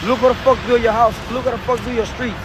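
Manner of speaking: normal talking voice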